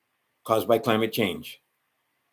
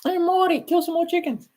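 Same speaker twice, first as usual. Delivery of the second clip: squeaky cartoon voice; 4 dB louder than usual. Mock cartoon voice addressing Morty.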